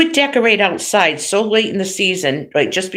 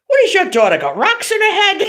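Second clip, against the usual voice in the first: high, exasperated imitation of an Italian dad; mock outraged father, pitched up; papa voice; 13 semitones higher than usual. Father scoffing at daughter's early Christmas tree, in a papa voice. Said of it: "I do the papa voice".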